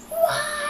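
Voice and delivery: high startled wail; mock wail. Child retelling Elephant's cry of alarm.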